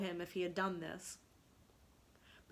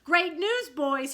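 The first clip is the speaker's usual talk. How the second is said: higher, louder joyful announcement; excited cry; 8 semitones higher, 12 dB louder, slower than usual. A narrator's reading. Peter bringing the boys a mother, excited cry. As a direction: burst out with the good news. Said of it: "he cried".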